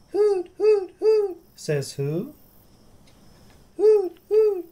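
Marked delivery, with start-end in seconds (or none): 0.1-1.4 high, airy owl hoots. Pretend. Owl in picture book. 3.8-4.7 high, airy owl hoots. Pretend. Owl in picture book.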